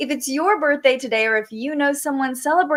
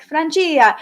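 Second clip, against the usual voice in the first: playful Italian "Francia", pitched up; Italian pronunciation; 5 semitones higher, 4 dB louder than usual. Acted. Host trying a viewer's name, Italian accent. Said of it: "The Italian in me is like".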